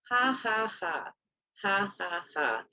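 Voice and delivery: sarcastic, spelled-out ha-ha-ha; hurt grandmother mocking the laughter aimed at her; dry fake laugh, each ha separate. Grandmother sarcastically mimics being laughed at.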